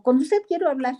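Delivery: put-on loud drunk demand. Drunk woman confronts the preacher.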